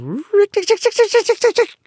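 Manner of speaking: very high, loud rapid-fire ticking; mongoose war cry. A narrator's reading. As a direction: trill out a shrill mongoose battle cry. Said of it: "his long war cry of".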